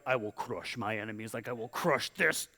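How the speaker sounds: low, menacing thug voice; growing threat, played as a low-voiced thug; mock thug. Thugs vowing to crush their enemies, low mock menace. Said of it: "Thugs would be like".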